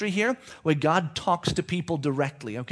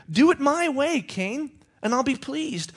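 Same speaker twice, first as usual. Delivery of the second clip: God lecturing; 9 semitones higher than usual. Acted.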